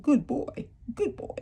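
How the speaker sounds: high sing-song praise like to a dog; high pet talk. Retold or imitated. Vlogger mimicking praise for a pet, high and cooing. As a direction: coo it up high like patting a dog's head. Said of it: "you know how you do with it".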